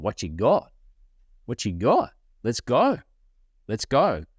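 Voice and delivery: punchy questions rising into a pep call; fired-up challenge. Acted. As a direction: square up playfully to the world. Acts out readiness to take on world.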